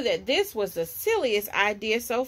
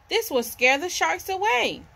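higher eager call; excited shout; 6 semitones higher than usual. Acted. Jess to George, bold excited call. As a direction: shout it out boldly. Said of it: "she shouted to George".

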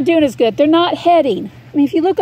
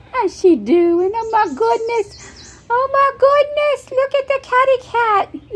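sweet high voice for the family cat; pet talk; 10 semitones higher, slower than usual. Real, not acted.

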